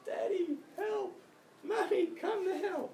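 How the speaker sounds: very high-pitched imitation of a needy kid; child crying for help. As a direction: squeal for mummy and daddy like a small child. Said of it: "so they cry out for help".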